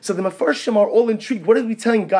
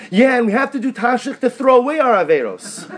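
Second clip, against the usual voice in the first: higher, louder imitation of an excited child; eager young daughter; 7 semitones higher, 5 dB louder than usual. Youngest daughter telling Tati what she learned, eager child imitation. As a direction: imitate a child proudly reciting a lesson.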